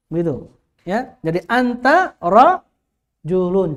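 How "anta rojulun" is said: "anta rojulun" drawn out slowly word by word; slow model reading. Shown as a demonstration. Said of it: "cara bacanya begitu". (contoh bacaan pelan "anta rojulun")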